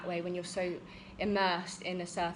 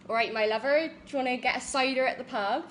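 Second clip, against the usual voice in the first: Bristol farmer accent, a bit louder; West Country accent; 4 semitones higher, 5 dB louder, faster than usual. Shown as a demonstration. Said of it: "which is the West Country accent".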